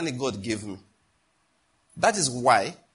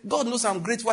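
defensive reply; 8 semitones higher than usual. Retold or imitated. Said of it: "he said".

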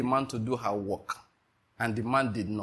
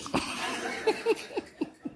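high, airy unvoiced laugh; a real burst of laughter; breathless laugh; 4 dB quieter than usual. Real, not acted. Preacher laughing at the beating story, airy and high.

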